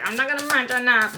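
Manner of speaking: lightly imitating her own stubbornness; mock stubborn self. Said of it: "I was like".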